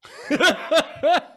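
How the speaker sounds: a loud, high cackle; high burst of laughter. Real, not acted. GM laughing at the player's terse plea, loud high laugh.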